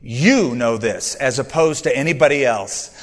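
"You" pressed hard at the very start, the rest falling away; stress on you. Shown as a demonstration. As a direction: lean on "You" to show it is God and no one else. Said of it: "the word "you" in those verses is emphasized".

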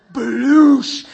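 loud laughing splash noise; splash sound. Retold or imitated. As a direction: burst out a big splash sound. Dog splashes into the lake.

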